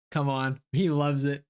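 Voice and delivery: normal talking voice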